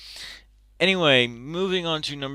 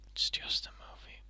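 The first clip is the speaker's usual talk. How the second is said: self-calming whisper; 14 dB quieter than usual. Real, not acted. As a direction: whisper "It's just a movie" to calm yourself. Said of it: "calm down".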